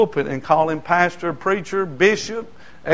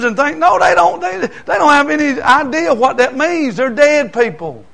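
high, loud, shouted preaching; fiery sermon shout, pitch climbing; preaching at full force; 11 semitones higher, 9 dB louder than usual. Acted. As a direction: hammer it home from the pulpit like a revival preacher.

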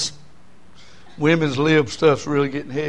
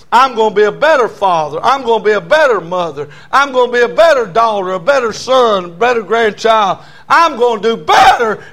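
rising, louder with each better; building preacher litany; 4 semitones higher, 6 dB louder than usual. Acted. Preacher rousing the congregation, building louder and louder. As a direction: drive each better harder and harder.

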